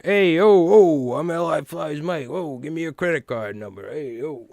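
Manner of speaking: loud, high hammed-up New York accent; a brash New York hustler pitching you, in a hammed-up New York accent. Streamer mocks a fake scam caller, loud hammed-up New York accent. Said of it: "New York accent".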